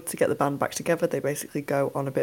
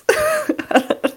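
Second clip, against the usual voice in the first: high burst of laughter; 5 dB louder than usual. Real, not acted. Laughing at the mad film.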